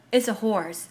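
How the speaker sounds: ordinary delivery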